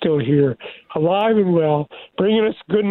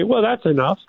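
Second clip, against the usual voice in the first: acting out a fed-up god, pitched up; lightly exasperated, rising high on the final question; mock exasperation; 7 semitones higher than usual. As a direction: play a god who thinks that's plenty. Mimics other gods' grudging answer.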